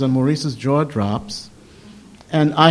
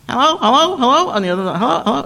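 much higher, calling hello into the phone; a high repeated phone greeting, louder at first; Maurice on the phone; 12 semitones higher, 4 dB louder, faster than usual. Retold or imitated. The caller imitating Maurice answering the phone: high calling hellos.

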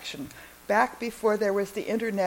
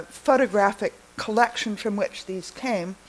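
normal talking voice

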